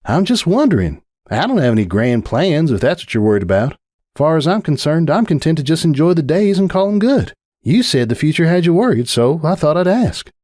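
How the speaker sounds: lightly voiced farm mare, relaxed; a touch of a teasing, content friend; country mare, easygoing. Farm mare reassuring her coltfriend about the future.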